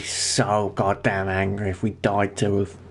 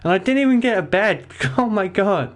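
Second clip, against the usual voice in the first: excited laughing; 9 semitones higher than usual. Real, not acted. Startled, laughing at game.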